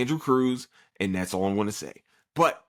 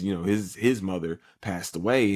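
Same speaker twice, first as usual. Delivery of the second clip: usual speaking voice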